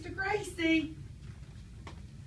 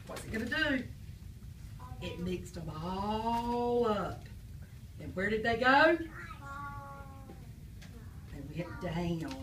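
put-on reading to children; 2 semitones lower, 6 dB quieter than usual. Teacher reading a picture book to the class, storybook voice. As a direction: draw the kids into the story.

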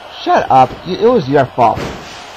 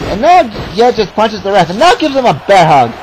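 high, raised-pitch match call; excited play-by-play; 6 semitones higher, 4 dB louder than usual. Real, not acted. Commentator as the wrestler punches the ref, excited call. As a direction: call the attack as it happens, voice up.

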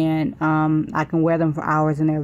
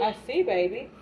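tender mother voice; 8 semitones higher, 4 dB quieter than usual. Real, not acted. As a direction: coo gently to the little one. Answering her small child.

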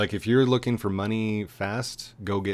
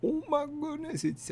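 surprised squeal; 4 dB quieter than usual. Real, not acted. Reacting to base tour.

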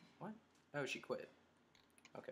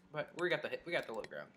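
ordinary talk, nothing marked

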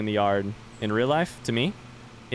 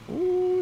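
startled gasp. Real, not acted.

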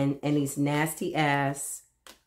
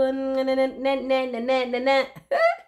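giggling "nenananana" laugh, higher; 7 semitones higher than usual. Real, not acted. A reader giggling goodbye to her viewers, high and playful. Closing laugh after love you.

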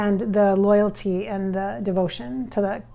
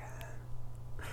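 whispered chuckle; 20 dB quieter than usual. Retold or imitated. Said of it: "And he kinda laughs. He's like".

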